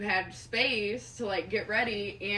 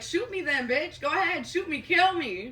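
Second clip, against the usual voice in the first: higher-pitched imitation of a defiant dare; retold taunting dare; 6 semitones higher than usual. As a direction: egg them on, as retold. Witness acts out his defiant taunt.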